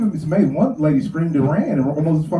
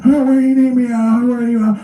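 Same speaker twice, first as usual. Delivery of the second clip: put-on louder, higher eager old man; old partner's voice; 4 semitones higher, 5 dB louder than usual. Old partner Stan answers the call for backup, eagerly. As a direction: sound like an old man keen to help. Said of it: "old partner Stan answers".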